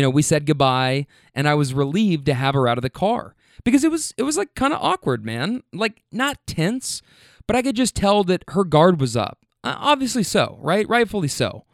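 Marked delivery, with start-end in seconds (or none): 3.6-8.2 higher, wound-up emphasis; emphatic. Real, not acted. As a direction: stress how awkward it was. Emphatic retelling.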